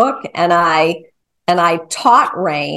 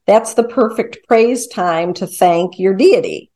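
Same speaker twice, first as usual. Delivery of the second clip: ordinary talk, nothing marked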